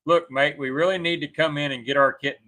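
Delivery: normal talking voice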